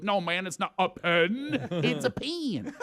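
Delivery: slow drawn "pen" said like pin; southern guy imitating a Philly accent, laughing. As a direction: golf buddy mocking, laughing. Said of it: "tries to imitate me".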